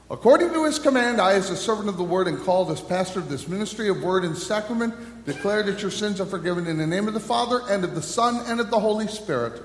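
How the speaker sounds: brisk, fast formal declaration; formal absolution. Acted. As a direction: pronounce the forgiveness with authority. Pastor pronounces absolution.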